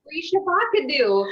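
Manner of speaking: high-pitched FREE SHAVACADO, "shuh-VOCK-uh-doo"; vine quote mispronunciation. Retold or imitated. Friend echoing the vine she loves, high playful "free shuh-VOCK-uh-doo". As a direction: echo it up high, stress on VOCK. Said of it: "I fucking love that vine".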